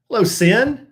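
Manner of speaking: ordinary delivery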